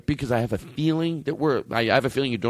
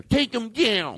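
higher-pitched mock outrage; indignant demand; 8 semitones higher, much slower than usual. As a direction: blurt it as a worked-up order. Acting out an angry demand.

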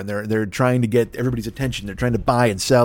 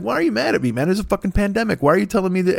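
high-pitched, pleading comeback; acted protest; 9 semitones higher, faster than usual. Answering the critic in his head, high aggrieved protest. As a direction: protest in a much higher voice.

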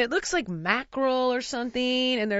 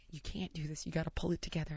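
hushed scolding; 5 semitones lower, 15 dB quieter, faster than usual. Retold or imitated. Wife hushes him at bar.

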